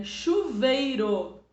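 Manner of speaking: teacher stretching chuveiro; slow example word. Shown as a demonstration. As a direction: say chuveiro slowly so the ei is heard. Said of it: "Se eu falo pra você assim".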